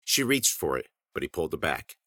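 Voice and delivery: ordinary delivery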